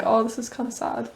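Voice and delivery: put-on a posh British accent, wistful and light. Friend re-quotes her in posh British accent, wistful. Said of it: "you're doing posh British accent".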